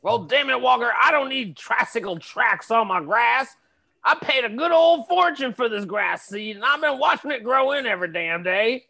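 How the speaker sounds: angry neighbor. Acted.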